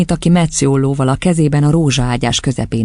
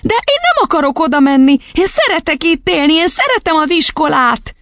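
put-on high, pleading little-girl voice; high childish wail of objection; protesting child; 14 semitones higher, 3 dB louder than usual. A narrator's reading. Girl protests against leaving.

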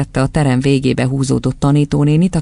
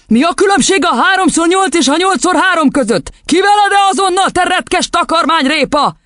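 high, furious lecturing; roaring tirade. A narrator's reading. Headmistress correcting the boy's multiplication, roaring. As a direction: drive it home in a rage. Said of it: "ordította Doron kisasszony".

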